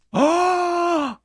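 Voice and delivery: long, loud, high-pitched "aah"; astonished scream. Real, not acted. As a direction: scream in amazement at the loot. Asombro ante el botín. (grito largo de asombro)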